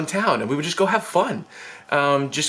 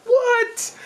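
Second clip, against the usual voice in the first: very high-pitched, stunned squeak; mind-blown squeal. Acted. Boy reacting to his first kiss, mind blown. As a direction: squeak it in acted disbelief. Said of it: "My mind was blown".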